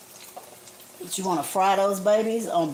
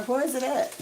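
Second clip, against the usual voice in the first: high, squeaky, softer call for the spatula; cutesy call; 8 semitones higher, 5 dB quieter than usual. Pretend. Searching the kitchen for her spatula, a high cutesy coo. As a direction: coo for it like a pet.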